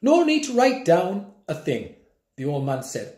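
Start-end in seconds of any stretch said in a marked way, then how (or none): none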